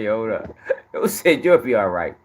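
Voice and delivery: wheezing the words out through the laughs; laughing. Real, not acted. Streamer replaying the clip for chat, laughing helplessly.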